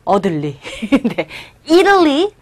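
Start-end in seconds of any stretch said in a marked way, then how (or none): 1.7-2.3 "Italy" with the first syllable punched high and loud; stressed opening syllable on "Italy" for students to copy. Shown as a demonstration. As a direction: hit the I of "Italy" hard. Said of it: "첫 번째 강세 주시고".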